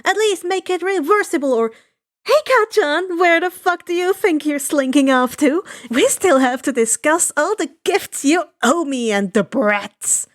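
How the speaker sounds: high, loud nagging across the hall; bossy yelling. Acted. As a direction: boss him around at full volume. Mitsuki keeps yelling at Kacchan.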